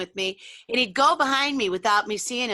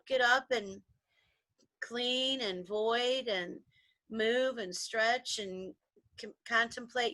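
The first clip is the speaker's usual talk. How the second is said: slow calm listing; 6 dB quieter, slower than usual. Real, not acted. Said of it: "calm morning routine".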